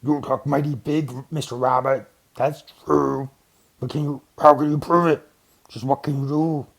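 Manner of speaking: lightly voiced bear, challenging; scornful bear. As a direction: taunt the little rabbit.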